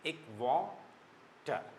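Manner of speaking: "वाटर" stretched very slowly into two syllables. Shown as a demonstration. Teacher slows "वाटर" down to show its syllables. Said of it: "दो syllable है".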